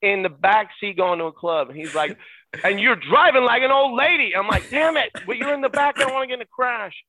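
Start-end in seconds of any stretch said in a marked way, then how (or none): 2.6-4.3 high, loud backseat complaint; retold heckling. Retold heckling from back seat. 4.7-6.9 high-pitched exasperated retort; flustered protest. Retold or imitated. Retold flustered reply while driving.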